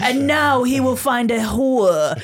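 slow, grand mock-heroic reading; hammed-up storybook voice, slow; mock epic narrator. Mock-epic voice mocking the book's line about guns. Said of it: "And he's like".

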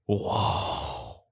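gasp, then a hushed, voiceless whoa; stunned text read-aloud. Retold or imitated. Co-host reading out her own shocked text, awed and hushed. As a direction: act out a dramatic awed reaction. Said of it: "my one after that says".

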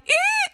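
very high squealed "eh"; imitated high-pitched wail. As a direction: mimic a child's piercing wail. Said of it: "a high-pitched thing".

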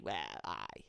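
whispered "well, aye", Geordie. Shown as a demonstration. Showing her friend how she says it, whispered. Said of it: "I go".

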